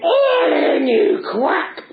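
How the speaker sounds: loud, high puppet shriek; outraged Punch. Acted. Punch snaps at the doctor's diagnosis, high mock-furious shout. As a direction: screech back in mock outrage.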